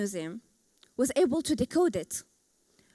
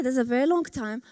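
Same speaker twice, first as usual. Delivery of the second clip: ordinary talk, nothing marked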